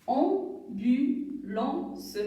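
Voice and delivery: Ambulance drawn out very slowly into syllables; slow syllable reading. Shown as a demonstration. Teacher reads Ambulance slowly for little pupils. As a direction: read Ambulance slowly, clapping each part. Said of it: "les lire tout doucement".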